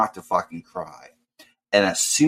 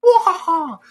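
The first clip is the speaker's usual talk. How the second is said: very high loud mock cry; mock wail; 5 dB louder than usual. Mocking Devin finding more boxes, very high loud mock wail. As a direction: squeal in hammed-up despair.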